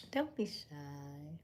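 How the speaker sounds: soft unvoiced whisper, slowing down; whispered coaxing. Real, not acted. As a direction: coax them gently in a whisper. Coaxing shy viewers to chat.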